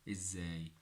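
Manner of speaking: normal talking voice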